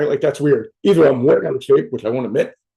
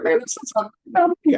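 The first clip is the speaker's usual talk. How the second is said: whiny mock; 10 semitones higher, 4 dB quieter than usual. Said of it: "Someone's like".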